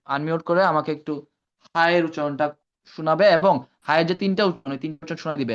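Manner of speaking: ordinary delivery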